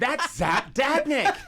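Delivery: hammered-up, incredulous yell of the name; insistent mock shout. [name] repeating himself to a baffled friend, insistent mock shout.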